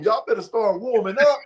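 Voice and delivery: high, half-laughing retelling; amused disbelief. Retold or imitated. Newcomer mocking the warm-up room, high amused voice. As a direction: let a giggle ride under it. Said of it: "I'm like".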